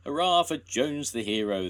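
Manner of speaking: loud high hurrah; cheering shout. A narrator's reading. The village cheering Jones, loud hurrah. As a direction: cheer him home. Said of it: "they shouted".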